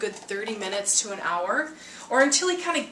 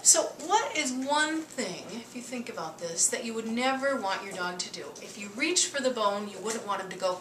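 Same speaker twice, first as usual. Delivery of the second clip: ordinary delivery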